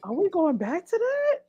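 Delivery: soft, high, slow questioning; mock disbelief. Reacting to what she was giving, mock disbelief. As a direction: ask it high and drawn out, incredulous. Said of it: "I was like".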